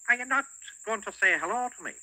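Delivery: high, loud cheeky goblin. Acted. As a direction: tease him as a goblin. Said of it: "said the goblin".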